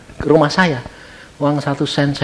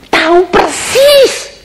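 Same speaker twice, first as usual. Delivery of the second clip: servant's loud high cry of disbelief; aghast servant; 13 semitones higher, 4 dB louder than usual. Retold or imitated. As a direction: recoil in high-pitched shock at the order. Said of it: "saya sampai gini".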